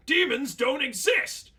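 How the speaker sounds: high, loud and slow shout; furious denial. Acted. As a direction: bellow the denial.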